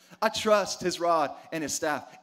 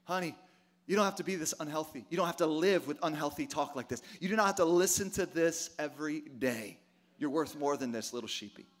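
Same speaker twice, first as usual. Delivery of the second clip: soft, low coaxing to a lost lamb; tender coaxing; 4 semitones lower, 5 dB quieter than usual. Pretend. God coaxing his little sheep home, tender and soft. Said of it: "They say".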